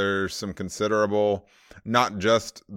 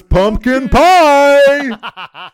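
laughing drawn out; 15 dB louder, much slower than usual. Real, not acted. Teasing a silly nickname.

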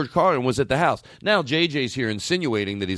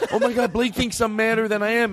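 laughing; 8 semitones higher than usual. Retold or imitated. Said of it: "I went".

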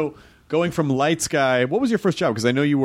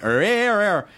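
high, choppy stuttered syllables; imitated autotune glitch; 8 semitones higher, slower than usual. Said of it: "and that's why it went".

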